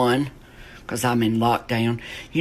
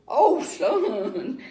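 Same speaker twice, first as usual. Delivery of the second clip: lightly voiced pained father, higher; pained dad; 11 semitones higher than usual. Army father at the football game, a hint of pained sighing. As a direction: sigh it like a father in pain. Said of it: "as if he was in deep pain".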